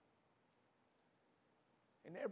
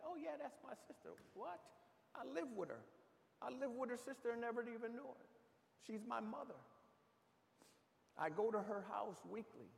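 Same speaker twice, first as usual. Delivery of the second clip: excited retelling; 11 semitones higher than usual. Retold or imitated. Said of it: "I said".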